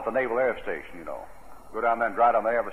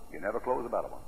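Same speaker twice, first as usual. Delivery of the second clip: softer repeat of You never close a better one; soft echo; 3 semitones lower, 8 dB quieter, faster than usual. Real, not acted. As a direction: drop it to a hush. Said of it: "and you never close a better one".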